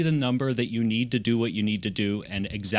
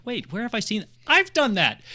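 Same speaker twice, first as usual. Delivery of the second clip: surprised; 11 semitones higher, 4 dB louder than usual. Retold or imitated. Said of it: "and I'm like".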